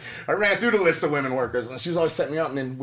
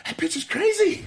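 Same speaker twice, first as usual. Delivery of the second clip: much higher and slower, aghast; high, slow, incredulous. Retold or imitated. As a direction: stretch it out up high in disbelief. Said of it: "I go".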